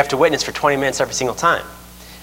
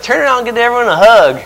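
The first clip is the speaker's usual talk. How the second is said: high, raised call to a congregation; preacher imitation; 9 semitones higher, 4 dB louder than usual. Preacher instructing his congregation, calling out high.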